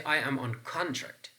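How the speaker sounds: "contract" as CON-tract in the example; stress on first syllable. Shown as a demonstration. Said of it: "whether this is contract or contract".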